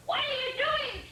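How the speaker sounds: high squawking hen voice. Acted. Hen squawking at the dog. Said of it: "she squawked".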